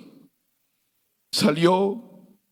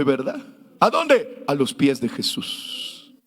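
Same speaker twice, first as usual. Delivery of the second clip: low, calm preaching voice; gentle sermon; 6 semitones lower than usual. Acted. Pastor urging the faithful to weep before Jesus, lower. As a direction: settle the crowd with a lower tone. Lower, softer exhortation about weeping.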